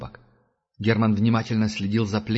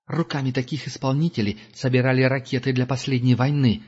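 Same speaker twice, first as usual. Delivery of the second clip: usual speaking voice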